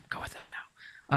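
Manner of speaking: breathy whisper, no voice. Real, not acted. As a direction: whisper it so others won't hear. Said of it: "why are we whispering".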